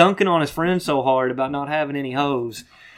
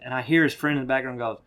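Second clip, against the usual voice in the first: ordinary talk, nothing marked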